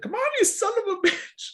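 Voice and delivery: put-on high strained old man curse; old man yelling. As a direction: bark it like a frail old man pushing. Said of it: "yelled and said".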